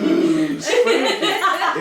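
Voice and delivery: high, wavering ghostly ooh through laughter; laughing, hammed-up fright; mock spooky. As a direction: tease with a fake haunted-house shiver. Mocking the misspelt Halloween sign.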